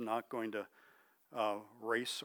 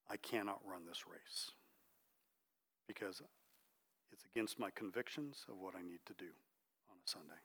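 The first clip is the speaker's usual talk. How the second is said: whispered refusal; 3 semitones lower, 10 dB quieter than usual. Retold or imitated. Eric refuses the committee, quoted.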